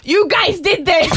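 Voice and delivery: slow, high-pitched accusing dad; mad dad. Retold or imitated. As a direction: blame the kids like an angry father. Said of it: "legitimately mad at us".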